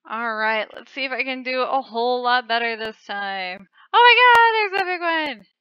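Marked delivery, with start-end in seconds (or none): 3.9-5.5 high, loud startled cry at a big worm; excited shout. Real, not acted. Gamer spotting a huge rival worm, loud startled shout. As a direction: blurt it out in a burst of alarm. Spots a giant worm in game.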